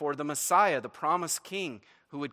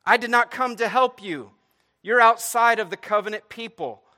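loud, raised, emphatic pronouncement; blunt rejection; 8 semitones higher, 10 dB louder than usual. Acted. Jesus letting the woman know she's outside, blunt and loud. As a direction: tell them firmly they don't belong. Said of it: "Jesus is letting this woman know".

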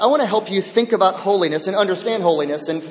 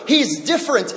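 usual speaking voice

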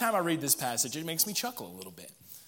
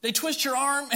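ordinary talk, nothing marked

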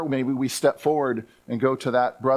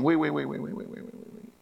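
fading wait; 5 dB quieter than usual. Real, not acted. Preacher stops the mock panic.

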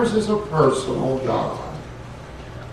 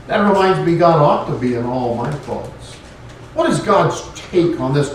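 normal talking voice

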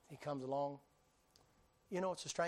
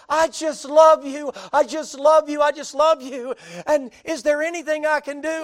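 much higher, very loud gushing thanks; gushing woman; 13 semitones higher, 21 dB louder than usual. Retold or imitated. Rescued woman gushing love at Bubba, shrill and loud. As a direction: gush over him, overjoyed. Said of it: "she said".

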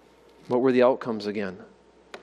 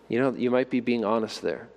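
normal talking voice

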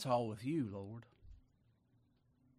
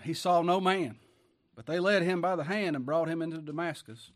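loud, raised preacher's reading voice; forceful scripture reading; 2 semitones higher, 7 dB louder than usual. Acted. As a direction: proclaim the verse, pitched up and ringing out. Reads scripture aloud forcefully.

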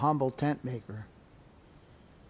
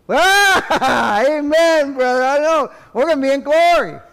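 much higher, louder, laughing; joyful shout; 11 semitones higher, 10 dB louder than usual. Real, not acted. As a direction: exult with joy.

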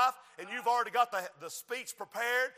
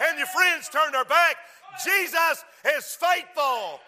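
louder, higher preacher's roar; rising pulpit fervor; preaching at full force; 4 semitones higher, 6 dB louder than usual. Acted. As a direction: drive it home to the congregation.

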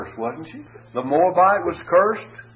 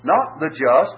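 usual speaking voice

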